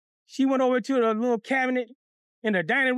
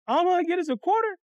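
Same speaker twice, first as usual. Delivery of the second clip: plaintive, high-pitched protest; pleading in disbelief, voice pushed up; dismayed question; 7 semitones higher than usual. Acted. Boy protests to Miss Johnson over his pay, dismayed.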